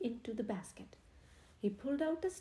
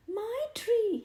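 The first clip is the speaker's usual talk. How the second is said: much higher, loud squeal; 10 semitones higher, 8 dB louder than usual. A narrator's reading. Henry sees his tree dragged off, squealing. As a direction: squeal in dismay. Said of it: "Henry squealed".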